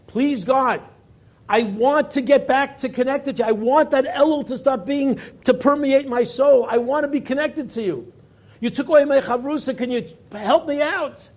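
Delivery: high, loud impassioned plea to God; pleading prayer. Retold or imitated. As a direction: cry out to God as if begging.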